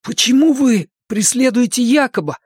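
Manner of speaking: put-on high, timid frightened boy; frightened babble. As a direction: stammer it out in fear. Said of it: "пролепетал Макс".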